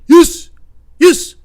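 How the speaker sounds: very loud, high yelped answers; odd store customer. Retold or imitated. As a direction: yelp it like the excited odd customer. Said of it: "And he's like".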